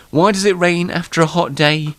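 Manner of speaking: usual speaking voice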